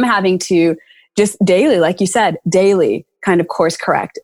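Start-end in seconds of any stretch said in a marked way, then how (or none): none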